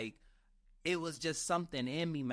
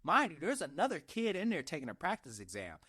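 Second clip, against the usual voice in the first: usual speaking voice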